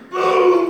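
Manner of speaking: high, loud imitated cannon blast; a preacher's booming cannon shot; shouted cannon boom.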